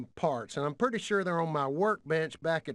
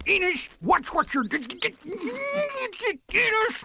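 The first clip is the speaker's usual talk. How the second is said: alarmed stammering yell; 13 semitones higher, 4 dB louder, slower than usual. Acted. Trophy dropped, alarm.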